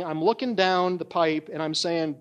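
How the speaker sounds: ordinary talk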